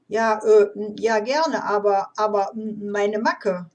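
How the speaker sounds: loud, flustered stammer with false starts. A narrator's reading. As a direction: trip over the words, blurting them out in bursts. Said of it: "stotterte dieser".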